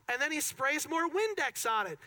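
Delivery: ordinary talk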